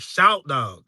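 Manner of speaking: Atlanta accent, SHOUT DOG drawn out slowly with no R in the first word. Shown as a demonstration. Streamer showing viewers how the rapper says his name, Atlanta accent. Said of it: "He has an Atlanta accent".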